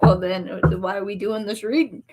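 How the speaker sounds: usual speaking voice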